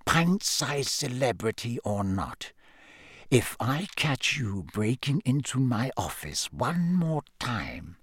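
slow, hushed threat; soft, dangerous. A narrator's reading. Snape threatens Harry over his office, softly dangerous. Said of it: "in a softer and more dangerous voice".

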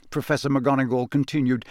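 usual speaking voice

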